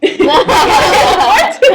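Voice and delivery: normal talking voice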